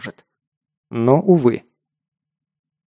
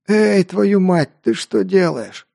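slow higher pained mercenary rasp; hoarse mercenary; 5 semitones higher, slower than usual. A narrator's reading. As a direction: groan it out, just waking up. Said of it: "прохрипел пришедший в себя наёмник".

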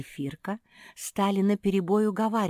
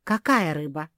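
baffled, a bit louder; bewildered question; 5 dB louder than usual. A narrator's reading. Зефирка puzzled by talk of fish, bewildered. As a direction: ask it completely lost. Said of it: "окончательно растерялась Зефирка".

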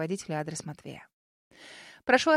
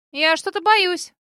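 put-on nervous girl voice; 9 semitones higher, 9 dB louder, slower than usual. A narrator's reading.

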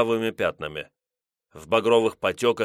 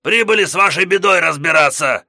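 battle roar; 9 semitones higher, 9 dB louder than usual. Acted. Gos shouting at headman.

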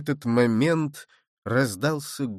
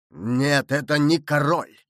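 emphatic, mildly raised cry; exclaimed denial; 3 dB louder than usual. A narrator's reading. Rider correcting the mistaken soldiers, exclaiming. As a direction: call out the correction forcefully. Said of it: "воскликнул всадник".

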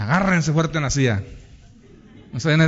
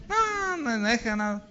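high, softer whiny refusal; reluctant husband; 5 semitones higher, 6 dB quieter than usual. Acted. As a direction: wave it away in a pitched-up voice. (negativa quejosa del marido, voz aguda)

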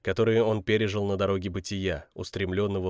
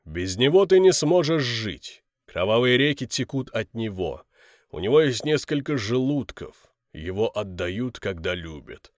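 giant guardian voice; 3 dB louder than usual. Acted. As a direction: pose the riddle like a giant. Giants pose a riddle.

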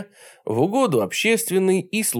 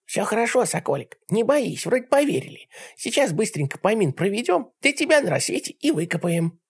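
put-on higher, hushed old granny voice; old woman whispering; 8 semitones higher than usual. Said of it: "бабка громко прошептала".

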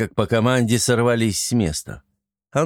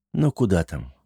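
ordinary delivery

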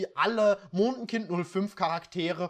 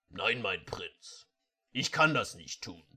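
much lower, slow servant voice; low, slow voice of the hesitant servant; Thunderbolt's refusal; 11 semitones lower, 4 dB quieter than usual. Acted. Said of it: "sagt Thunderbolt".

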